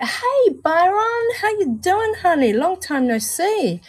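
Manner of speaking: high, loud, gushing hello to a viewer; excited greeting. Real, not acted. Greeting Byron who just joined the chat, gushing and high.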